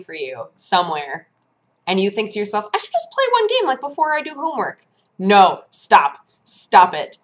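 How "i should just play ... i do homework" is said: much higher wheedling voice, a bit fast; mock tempted voice. Mocking a student tempted before homework, high wheedling voice. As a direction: play the sneaky inner voice.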